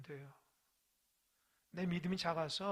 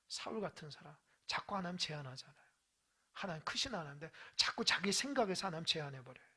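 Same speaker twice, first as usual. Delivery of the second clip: ordinary talk, nothing marked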